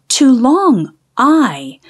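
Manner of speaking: long I drawn out slowly. Shown as a demonstration. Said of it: "changes from short I".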